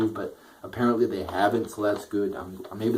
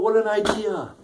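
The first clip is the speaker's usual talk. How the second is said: sarcastic; 10 semitones higher than usual. Real, not acted. Sarcastic remark on obvious idea.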